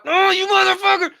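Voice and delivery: high furious yell; mock freakout. Showing viewers the asshole way, furious yell. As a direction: scream it like a hothead losing it. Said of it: "like an asshole and freaking out".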